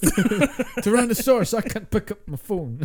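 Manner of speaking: slow, high, loud cartoon dino; silly dinosaur voice. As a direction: whine like a dinosaur with tiny arms, laughing. Voicing a dinosaur joke.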